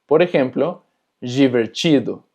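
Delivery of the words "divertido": divertido as "jiverchido"; DI como ji. Shown as a demonstration. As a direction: say di as "ji" and ti as "chi" in divertido. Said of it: "también se pronuncia ji". (divertido dicho «jiverchido»)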